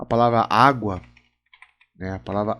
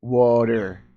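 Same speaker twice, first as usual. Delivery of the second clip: American "Water" with the t turned into a tapped r sound; American-accented "Water", like WAH-der. Shown as a demonstration. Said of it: "O T, re".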